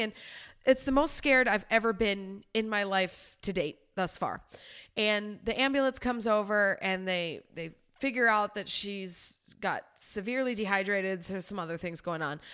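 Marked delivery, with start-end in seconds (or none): none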